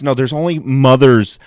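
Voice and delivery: usual speaking voice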